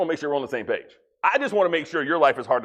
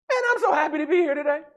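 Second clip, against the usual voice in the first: much higher, fast fake chirpy tone; mock cheer; 11 semitones higher, much faster than usual. Exhausted person pretending to be happy, mock chirpy. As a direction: sell it as hollow fake cheer. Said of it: "Nobody gets exhausted and goes".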